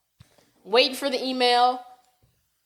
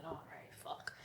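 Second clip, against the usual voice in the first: whispered under the breath, voiceless; a muttered "fuck" kept from the coach; muttered curse; 7 semitones lower, 26 dB quieter, slower than usual. Retold or imitated. Telling the story, a voiceless aside swear. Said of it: "And I said".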